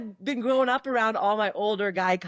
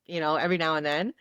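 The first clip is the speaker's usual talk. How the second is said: ordinary talk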